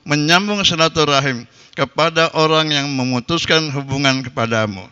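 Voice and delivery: usual speaking voice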